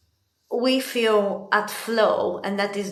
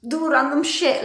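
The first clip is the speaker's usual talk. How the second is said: higher, louder frantic self-parody; mock anxious self; 7 semitones higher, 5 dB louder than usual. Mocking her anxious self rushing into tasks, frantic and high. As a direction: play your own jittery panic, hammed-up. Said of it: "'Cause I'm anxious and I'm like".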